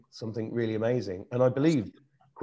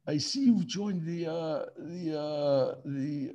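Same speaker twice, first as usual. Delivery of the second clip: high, halting old-commander impression; slow, drawn-out mimicry, groping for the word; Prince Philip; 5 semitones higher, much slower than usual. Commander-in-chief inspecting a young recruit's uniform, hesitant impersonation. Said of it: "he, he went".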